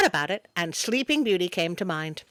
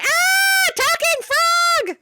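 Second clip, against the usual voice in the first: high, drawn-out squeal of alarm at a frog; mock startled shriek; 6 dB louder, much slower than usual. Narrator mocking the queen's calm, a high startled squeal. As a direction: shriek it like you just saw the frog talk. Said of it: "mock reaction to talking frog".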